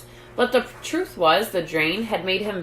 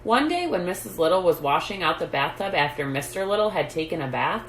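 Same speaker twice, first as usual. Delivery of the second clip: ordinary delivery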